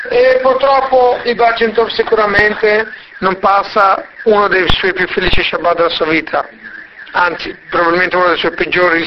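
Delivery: ordinary delivery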